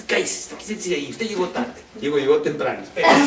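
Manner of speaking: hammed-up bossy host ordering a guest; a host scolding guests who came on time, mock stern. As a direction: boss the early guest around in jest.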